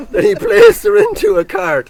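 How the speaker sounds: high, laughing read; laughing through words. Real, not acted. Reader amused by the grim story, laughing through the line.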